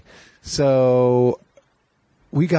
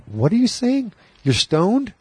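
much higher, puzzled shout over noise; confused question; 11 semitones higher, slower than usual. Retold or imitated. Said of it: "I was like".

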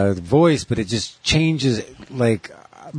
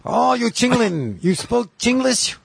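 hammed-up Chinglish caricature, pitched way up; mock Chinglish accent; 11 semitones higher, slower than usual. Riffing on Chingland, exaggerated mock Chinglish accent. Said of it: "they call that Chingland".

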